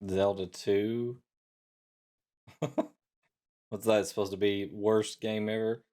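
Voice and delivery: ordinary talk, nothing marked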